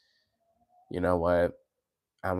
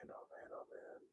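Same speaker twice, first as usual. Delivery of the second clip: whispered sigh; 25 dB quieter than usual. Real, not acted. Sick streamer sighing to himself.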